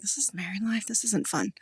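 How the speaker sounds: dropped to a near whisper; hushed inner thought. Retold or imitated. Newlywed teacher recalling her own thoughts, whispered. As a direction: whisper the bleak thought to herself. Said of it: "I'm like".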